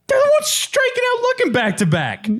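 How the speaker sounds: very high, whiny protest; mocking player retort. Player sulking back at the coach, mock whiny high protest. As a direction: squeak out an indignant comeback. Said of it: "And you're like".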